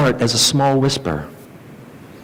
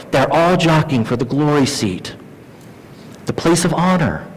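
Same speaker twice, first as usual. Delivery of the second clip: ordinary talk, nothing marked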